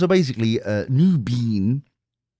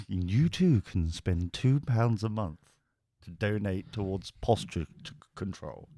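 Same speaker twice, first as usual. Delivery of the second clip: mock charity appeal; 2 semitones lower, 6 dB quieter than usual.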